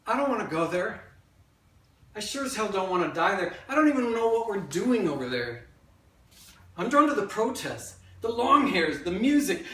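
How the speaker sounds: loud stage monologue, rising in force; impassioned storytelling. Acted. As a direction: build insistently as a young man refusing the draft. Acts younger self facing the draft.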